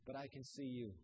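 low, soft and calm, a father steadying his son; gentle father's reassurance. Acted. Father calling up to his son on the roof, low and soft. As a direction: say it quietly, like a promise.

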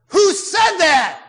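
thundering roar of a furious leader. Retold or imitated. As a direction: roar it out to silence everyone. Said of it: "roared Khrushchev".

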